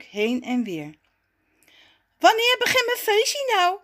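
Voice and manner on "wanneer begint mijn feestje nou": high, loud, impatient little whine of a call. A narrator's reading. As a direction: call out, can't wait any longer. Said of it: "roept hij ongeduldig". (ongeduldig roepen, hoog en luid)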